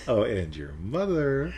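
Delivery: slow, drawn-out rising tease; playful tonality change. Shown as a demonstration. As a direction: draw out MOTHER with a playful lift. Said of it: "Versus it's like".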